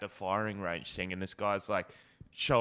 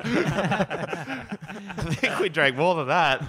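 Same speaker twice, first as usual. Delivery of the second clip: slow, higher words squeezed out through laughter; laughing; 6 semitones higher, 6 dB louder, much slower than usual. Real, not acted. Admitting they drank more, slow and laughing. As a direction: talk through the giggles.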